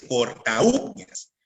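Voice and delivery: corta uñas slowed, with a long stressed U in uñas; corta-Ú-ñas in hiatus. Shown as a demonstration. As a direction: lean on the U of uñas, apart from corta. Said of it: "se pronuncia más la U".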